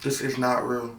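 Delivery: quiet, low, slow disbelief; stunned. Retold or imitated. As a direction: murmur in numb disbelief. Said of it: "I'm just like".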